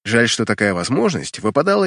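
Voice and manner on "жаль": plain reading voice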